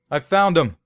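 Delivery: high triumphant shout; high excited shout. Acted. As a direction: shout it out to the others. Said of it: "Peters shouted".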